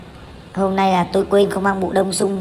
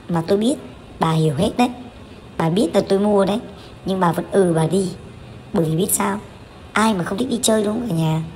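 usual speaking voice

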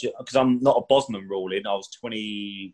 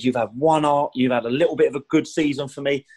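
higher-pitched imitated tirade from the boss; retold angry rant; 5 semitones higher than usual. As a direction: rant at the cheeky young striker. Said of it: "he just went into one of me".

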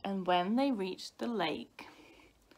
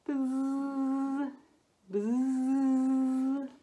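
long, higher steady buzz; bee buzz; 4 semitones higher, 3 dB louder than usual. A narrator's reading. Bees at their hive, sustained buzzing.

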